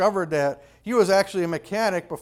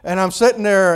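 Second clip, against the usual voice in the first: usual speaking voice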